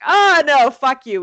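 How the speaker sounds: exaggerated retold rebuff; mock refusal. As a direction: play cops brusquely turning someone down. Retold cops refusing to return card.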